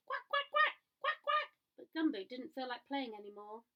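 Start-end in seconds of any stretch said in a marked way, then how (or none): 0.1-1.5 very high, loud duck quacks; squeaky duck calls behind him; duck quacking. A narrator's reading. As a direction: quack to get his attention. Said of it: "A duck quacked behind him".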